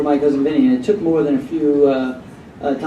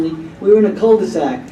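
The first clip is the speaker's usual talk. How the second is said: ordinary talk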